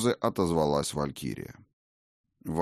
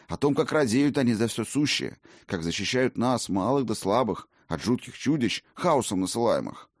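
eager innkeeper; 2 semitones higher than usual. Acted. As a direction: gush quickly, eager to please.

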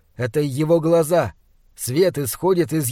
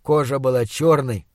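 plain reading voice

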